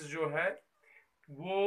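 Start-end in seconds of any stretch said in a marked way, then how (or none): none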